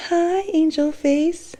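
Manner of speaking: high slow cooing. Real, not acted. Cooing to baby.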